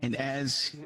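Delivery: soft, hushed scene-setting; low-key suspenseful narration; game master narration. A narrator's reading. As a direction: draw the players in quietly.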